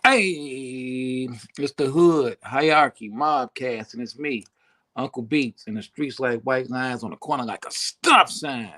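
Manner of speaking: loud announcer hype, punchy; loud podcast host hype; hype show intro. Acted. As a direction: pump up the audience like a host. Hosts show intro loudly.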